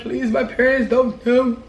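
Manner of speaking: mock-crying plea with a laugh in it; hammed-up whimpering plea; tearful begging. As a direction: beg as if blubbering, played for laughs. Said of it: "I'm crying, I'm like".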